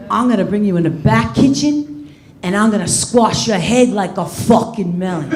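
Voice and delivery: low gruff tough-guy growl; De Niro impression. De Niro ordering lunch, threatening the waiter in a low gruff voice. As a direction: rasp it out like a mob boss ordering lunch. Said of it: "he goes like this".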